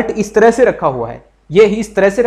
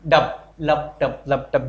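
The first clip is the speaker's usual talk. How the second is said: slow, low lub-dub like a heartbeat; heartbeat imitation; 5 semitones lower, 4 dB quieter, slower than usual. Shown as a demonstration. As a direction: sound out the heartbeat slowly. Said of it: "लब डब की sound".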